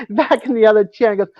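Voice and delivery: ordinary talk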